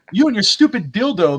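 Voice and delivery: lightly voiced scorn; mock jeer. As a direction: imitate a taunting rival. Retelling werewolf's jeering insult.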